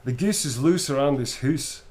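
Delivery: a quick Scottish accent, hoose for house; a light Scottish accent demo. Shown as a demonstration. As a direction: say it like a Scot, rolling the R. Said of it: "a very quick Scottish accent".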